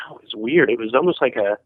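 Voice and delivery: normal talking voice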